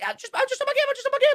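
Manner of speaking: very high-pitched frightened protest; re-enacting a scared kid caught gaming; imitated panicked pleading. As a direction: plead innocence in a squealing mock panic. Acts frightened reply to dad.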